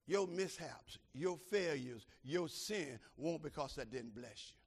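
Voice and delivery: usual speaking voice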